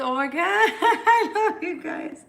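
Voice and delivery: high, slow, laughing through tears; laugh-crying. Real, not acted. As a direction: gush love at the viewers while laugh-crying. Emotional goodbye to viewers.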